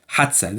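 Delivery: quick colloquial Egyptian حادثة, middle vowel dropped; fast Cairo pronunciation. Shown as a demonstration. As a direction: squeeze حادثة into two quick syllables with an s, Cairene style. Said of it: "We just say it fast".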